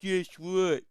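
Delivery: put-on sing-song it like a little kid; mocking a chatter's kid-level insult; childish taunt. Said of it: "It's like".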